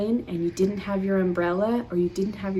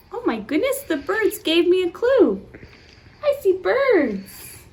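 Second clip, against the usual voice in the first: high, bright surprise, slowing on "I see birds"; excited discovery; 7 semitones higher, 3 dB louder than usual. Acted. Reading to children, pointing out birds with excited surprise. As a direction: gasp of delight for the kids.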